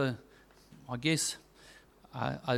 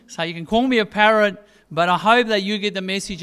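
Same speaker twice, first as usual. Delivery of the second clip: louder, driving the point home; preaching at full force; 3 semitones higher, 6 dB louder than usual. Acted. Preacher hammering the lesson to his congregation, louder. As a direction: lean in and press the message on them.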